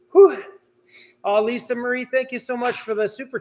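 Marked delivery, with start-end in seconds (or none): 0.1-0.4 loud, high puff of relief; breathless whew. Real, not acted. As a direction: blow out a big winded whew. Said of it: "now I'm out of breath".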